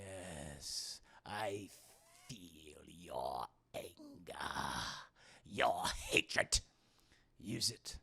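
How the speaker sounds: low, slow sinister whisper; tempting devilish whisper. Acted. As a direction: whisper it like an evil emperor. Palpatine tempts Luke to anger.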